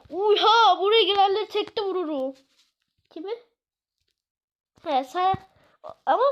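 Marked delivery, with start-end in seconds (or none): 0.1-2.3 louder, higher hammed-up threat; mock tough guy threat. Gamer hamming up a tough shooter, loud and cocky. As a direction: puff up like a cocky gunman.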